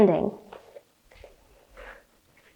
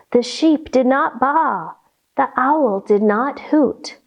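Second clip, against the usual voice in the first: ordinary talk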